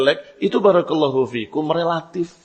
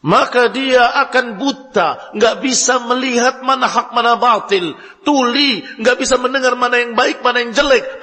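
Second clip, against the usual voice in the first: a passionate sermon climax; preaching at full force; 8 semitones higher, 4 dB louder than usual. Acted. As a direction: thunder the warning from the pulpit. Preacher warns of blind ambition.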